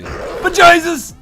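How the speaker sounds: high, slow, loud exclamation; surprised yell. Real, not acted. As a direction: cry out in shock. Shocked by the dog's attack.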